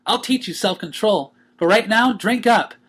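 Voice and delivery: high, cheery pony voice; bright and teasing; Pinkie, joyful. A narrator's reading. Pinkie greeting the waking Twilight, high and cheery.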